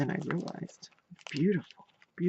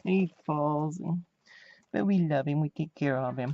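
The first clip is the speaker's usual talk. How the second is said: ordinary talk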